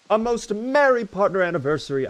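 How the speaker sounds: high, cheerfully formal cartoon voice; Pim's voice. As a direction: read it like a chipper little cartoon character. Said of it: "reading that like Pim's voice from Smiling Friends".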